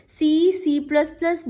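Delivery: ordinary talk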